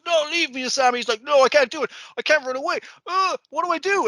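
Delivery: high, frantic, whiny pilot voice; panicked young pilot. Retold or imitated. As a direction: squeal it out like a terrified kid.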